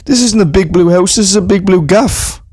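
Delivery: put-on lairy young lad in tracksuit; lad at a gaff. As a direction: call out to the boys, cocky. Sketch, lads at a house party.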